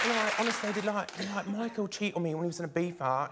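put-on Towie accent. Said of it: "the voices from Towie".

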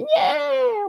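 sudden high infant squeal; baby cry imitation. Narrator imitates the doorstep baby crying, sudden high squeal. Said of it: "Bueno, esa es mi, mi versión".

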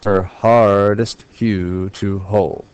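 very slow, stressing "hard", "hue" and "hold"; "Her hardest hue to hold" in a drawn-out weak-strong rhythm; iambic scansion. Shown as a demonstration. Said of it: "I'm looking for three iambs per measure".

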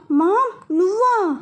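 rising startled "Mom, you?"; shocked. Acted. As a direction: gasp it out in disbelief. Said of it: "Siddhu ఆశ్చర్యంగా".

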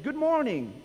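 high, loud mock good morning; retold greeting. Pastor retelling his slip at Vespers, bright and high. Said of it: "yesterday I said at Vespers".

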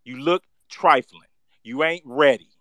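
usual speaking voice

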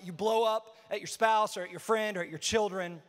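ordinary talk

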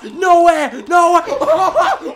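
can't believe the win, laughing; laughing disbelief. Real, not acted. Disbelief at winning.

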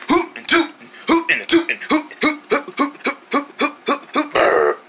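high, rhythmic little hoots; singsong mock owl hoots; mocking hooting imitation.

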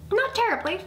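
high little mouse voice; small, high-pitched critter; squeaky mouse. A narrator's reading. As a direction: pipe up like a tiny mouse. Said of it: "squeaked Mouse".

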